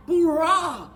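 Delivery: ordinary delivery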